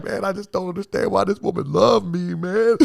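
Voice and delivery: high-pitched, hammed-up weepy whine; mock crying. Man confessing he cries over his girl, mock weepy. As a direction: wail it like a comic imitation of crying. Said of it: "I'm, like, crying".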